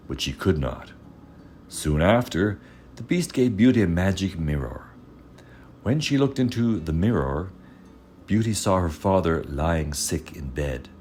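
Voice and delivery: usual speaking voice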